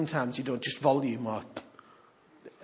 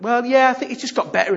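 dismissive patient; 9 semitones higher, 10 dB louder than usual. Retold or imitated. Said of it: "they say things like".

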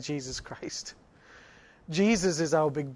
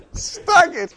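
higher, louder, laughing almost to tears; 9 dB louder than usual. Real, not acted. Preacher describing the bully falling over, laughing. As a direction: wheeze it out through laughter.

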